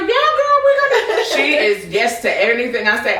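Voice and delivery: put-on high, loud, laughing girlfriend voice; hyped-up yes-friend. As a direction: gush like the friend who agrees to everything. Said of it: "my one friend that's just like".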